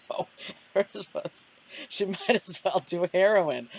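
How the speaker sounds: talking through real laughter; laughing. Real, not acted. Retelling her dark notes and cracking up, laughing. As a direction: crack up while getting the words out.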